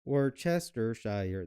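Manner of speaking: Worcestershire read slowly letter by letter as wor-ces-ter-shi-re; spelled-out Worcestershire. Shown as a demonstration. Cook reading the label literally, slow five-syllable Worcestershire. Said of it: "That's five".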